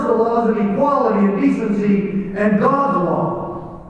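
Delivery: loud, high, driving sermon voice; fired-up pulpit delivery; preaching at full force. Acted. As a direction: preach it to the back row. Preaching about constitutional sheriffs.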